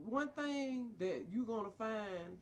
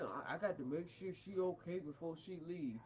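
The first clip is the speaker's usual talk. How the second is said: mocking quote; 6 semitones lower, 4 dB quieter than usual.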